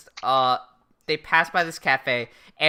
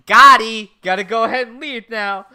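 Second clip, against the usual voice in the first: loud, higher-pitched acted quote; mock exclamation; 5 semitones higher, 11 dB louder than usual. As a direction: punch out the first word, high and loud. Acting Misa's gleeful getaway.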